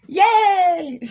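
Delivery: high, loud yay; excited cheer. Real, not acted. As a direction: cheer like the crowd just won. Cheering in live chat.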